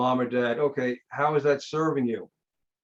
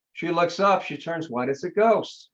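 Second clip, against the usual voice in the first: normal talking voice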